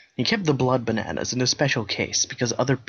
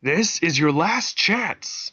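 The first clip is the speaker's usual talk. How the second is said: slow mock shout; 6 semitones higher, much slower than usual. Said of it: "he shouted".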